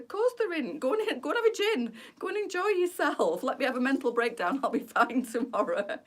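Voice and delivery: high, bright mock reassurance; mock cheerful. Telling Lisa to go have a gin, high mock cheer. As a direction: brush it off with exaggerated cheer. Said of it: "I said".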